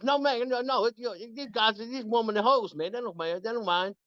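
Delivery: high, coaxing bandmate voice; insistent buddy. Retold or imitated. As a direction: coax him, dismissive and sure. Bass player insisting.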